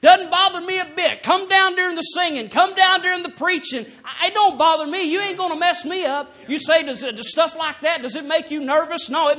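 high, loud, hammering revival preaching; preaching at full force. Acted. Preacher urging the church to let go, shouting. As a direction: drive it home like a tent-meeting preacher, shouting.

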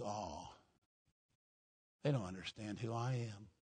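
the snake plotting in a hushed, sly murmur; scheming snake. Retold or imitated. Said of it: "that snake sits there and says".

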